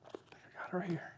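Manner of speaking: whispered under the breath; whispered aside. Real, not acted. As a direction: drop to a whisper, just for yourself. Preacher mutters aside, unvoiced whisper.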